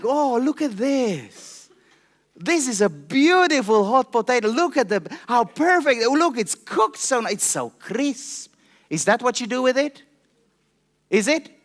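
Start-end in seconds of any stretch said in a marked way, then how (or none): 0.0-8.5 mock admiring. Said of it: "do I go and like".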